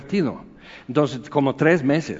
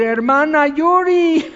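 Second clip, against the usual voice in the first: eager fan calling; 12 semitones higher, 4 dB louder, slower than usual. Retold or imitated. Fans pushing to greet the singer, high adoring call.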